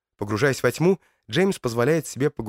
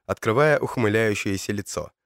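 plain reading voice

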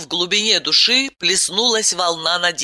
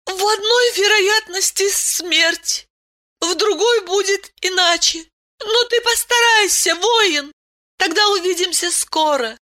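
Aylen's voice. A narrator's reading. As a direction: voice her gently, well above your own. Female character Aylen voiced.

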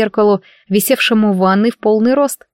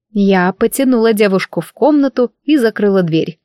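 plain reading voice

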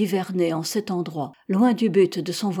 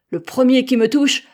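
loud, higher-pitched angry threat; threatening cry; 5 semitones higher, 8 dB louder than usual. A narrator's reading. Sailor warning men coming to seize him, threatening cry. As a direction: cry it out, beside yourself with anger. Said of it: "s'écria-t-il".

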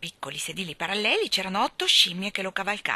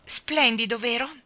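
higher, proud showing-off; proudly; 5 semitones higher, 4 dB louder than usual. A narrator's reading. Lion shows the children the crowded hall, proud. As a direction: show off the hall with pride. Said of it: "disse il leone con orgoglio".

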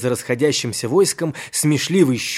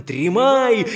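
wail; 7 semitones higher than usual. Acted. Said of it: "с воплем".

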